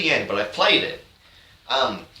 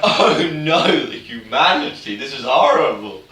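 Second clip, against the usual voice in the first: mock despair; 2 semitones higher, 4 dB louder than usual. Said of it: "everyone's like".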